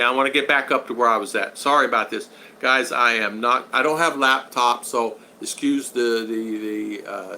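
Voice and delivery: ordinary talk, nothing marked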